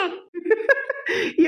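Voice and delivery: soft giggling; light, tittering laughter; amused laugh. Acted. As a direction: giggle at their worry. Laughing at the mother-in-law's fears.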